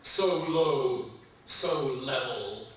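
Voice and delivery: slow, measured love poem reading; poetry reading. Acted. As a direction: linger on each phrase tenderly.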